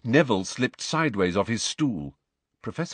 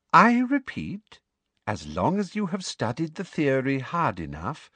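insistent repetition; 8 semitones higher than usual. A narrator's reading. Professor repeating her answer to doubting students, primly insistent. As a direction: spell it out primly, pitched up.

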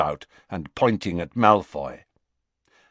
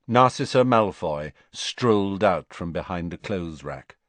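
plain reading voice

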